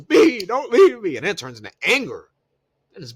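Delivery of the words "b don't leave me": high, loud, slow mock sob; mock crying plea. Naomi begging Bianca not to leave, in a hammed-up sob. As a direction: whine it like a heartbroken friend. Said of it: "She's crying still".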